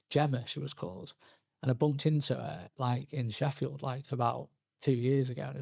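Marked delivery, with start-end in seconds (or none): none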